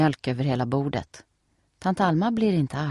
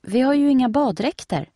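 lightly voiced young girl, a touch higher; a hint of a doubtful girl; girl's voice; 9 semitones higher, 5 dB louder than usual. A narrator's reading. Steffi answers Nelly, lightly voiced girl.

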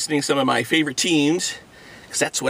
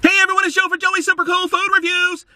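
fast intro; 12 semitones higher, faster than usual. Real, not acted. Fast channel intro again.

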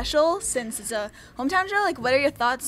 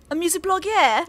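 light, higher mock British; mock British intro; 5 semitones higher than usual. Host starting the interview intro, light mock British. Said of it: "start our intro".

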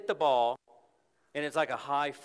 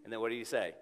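ordinary talk

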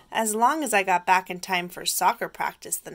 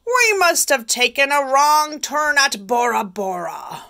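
pirate captain; 6 semitones higher, 7 dB louder than usual. Acted. Pirate captain voiced in story.

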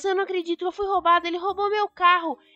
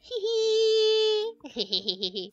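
higher triumphant laugh; gloating laugh; 6 semitones higher than usual. Acted. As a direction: laugh at the thief behind bars. Gloating at the jailed thief.

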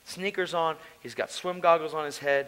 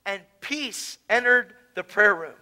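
usual speaking voice

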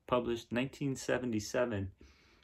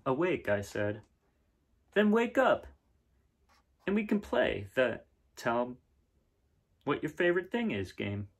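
brighter, livelier read-aloud voice; story narration; 4 semitones higher, 3 dB louder than usual. A narrator's reading. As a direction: read it to the kids with wonder. Reads picture book aloud.

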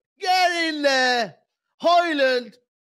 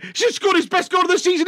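ordinary talk

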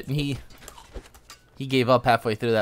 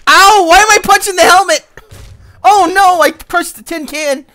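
much higher, loud yelps; surprised shouting; 12 dB louder than usual. Real, not acted. Gamer punching an armored foe, loud comic alarm.

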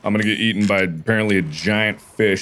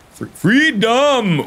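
excited shout; 14 semitones higher, 4 dB louder, much slower than usual. Real, not acted. Calling out for freedom, excited.

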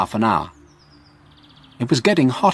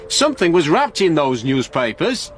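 raised pitch, mildly shouted; shouting; 7 semitones higher than usual. A narrator's reading. Frank tells police about the newspapers, shouting. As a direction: call it out urgently, pitched up. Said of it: "shouted Frank".

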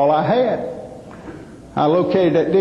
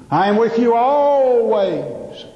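slow preaching quote; 4 semitones higher, much slower than usual. Retold or imitated. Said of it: "the good book says".

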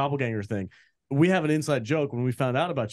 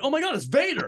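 excited; 14 semitones higher than usual. Retold or imitated. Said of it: "And I was like".

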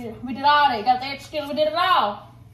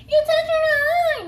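high mock voice; 6 dB louder than usual. Mocking someone's complaint.

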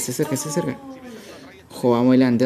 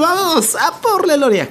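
much higher, slow drawn-out battle cry; triumphant cry; 12 semitones higher, 3 dB louder, slower than usual. Acted. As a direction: stretch it out like a rallying call.

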